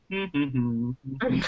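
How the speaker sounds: soft, idle humming while tinkering; casual humming. Retold or imitated. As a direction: hum to yourself like it's nothing. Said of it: "he'd be like".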